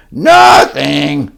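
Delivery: defiant loud reply; loud nothing. Acted. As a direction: bark it like a sulky kid. Grover's defiant daily answer.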